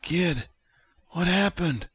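high, weak, strained voice; Vic. A narrator's reading. Haggard patient asks the kid what happened, weakly. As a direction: ask feebly, short of strength. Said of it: "Vic took a haggard breath".

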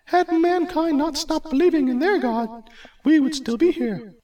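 very high goddess lecturing; Hera, haughty. Acted. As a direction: put the mortal in his place.

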